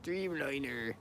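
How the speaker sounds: Dreamliner in a light Cornish accent, r rolled into the end. Shown as a demonstration. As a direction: say Dreamliner like a Cornwall local. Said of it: "Say it in a Cornwall accent".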